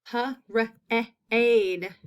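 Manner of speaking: parade broken into pa, r, eh, ade; sounded out. Shown as a demonstration. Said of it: "That's in that second syllable".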